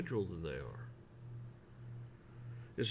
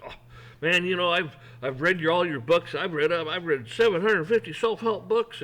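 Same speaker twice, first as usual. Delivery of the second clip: louder, higher, gushing about every book; pumped-up fan; 5 semitones higher, 9 dB louder than usual. Retold or imitated. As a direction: brag like an overeager fan. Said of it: "Man, he's all pumped".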